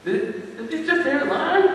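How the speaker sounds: hesitant start, then high-pitched question; comic wary herd leader; uneasy wildebeest. Acted. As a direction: stammer softly, then lift it into a worried question. Nervous wildebeest asks about lion.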